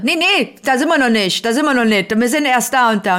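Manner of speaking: lauter gerufen, nachgeahmt; nachgemachte Zurufe. Retold or imitated. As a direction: ruf es den Leuten in der Bahn zu. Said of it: "riefen aber ein paar andere". (Fahrgäste rufen dem blinden Mann zu, lauter)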